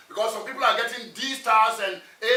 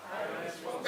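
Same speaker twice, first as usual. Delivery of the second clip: whispered; 4 semitones lower, 12 dB quieter than usual. Shown as a demonstration. Whispered repeat.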